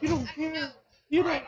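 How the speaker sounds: very high, put-on wounded squeak; mock-hurt. Coach playfully accuses student of not caring, high mock-hurt voice. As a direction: pretend to be hurt in a tiny high voice.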